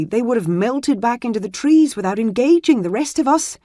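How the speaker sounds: put-on distressed young squire. A narrator's reading. A shaken young squire telling the healer of the ambush. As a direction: report the ambush in a rush of distress.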